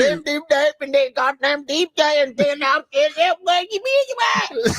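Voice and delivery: high, slurred nonsense talk; garbled mock speech. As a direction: mumble it like someone who can't speak.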